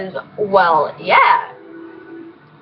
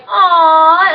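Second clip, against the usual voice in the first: 1 cooing fans; 6 semitones higher, 7 dB louder than usual. Retold or imitated. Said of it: "some of them will be like".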